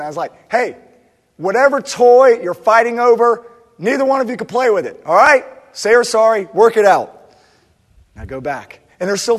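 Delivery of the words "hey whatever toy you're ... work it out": loud, higher parental yell. Retold or imitated. Dad yelling upstairs to stop the girls fighting, loud. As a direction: bellow it up the stairs, firm dad. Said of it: "I yell up once again".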